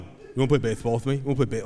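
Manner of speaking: fast, repeated lisped pestering; lisping four-year-old. Retold or imitated. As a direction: keep nagging dad as a lisping little boy. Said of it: "he just kept going".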